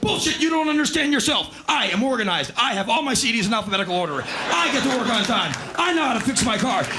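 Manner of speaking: hammed-up lecturing yell; mock rant. Husband rants at crying wife.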